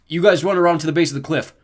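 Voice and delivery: shouted plan; urgent order. A narrator's reading. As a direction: direct them while fleeing. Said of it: "Beckendorf said".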